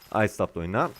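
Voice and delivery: ordinary talk, nothing marked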